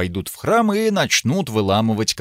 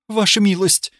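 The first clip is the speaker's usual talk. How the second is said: higher guilty cry of protest; guilty exclamation; 8 semitones higher than usual. Acted. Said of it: "Фрида воскликнула виновато". (виноватый возглас)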